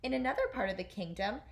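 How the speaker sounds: usual speaking voice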